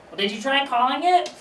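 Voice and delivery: high, rising grandma voice; imitated grandmother. Grandmother advising aunt about a lost pen, high imitated voice. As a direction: pitch the question way up, lilting to the end. Grandma's absurd suggestion, acted out.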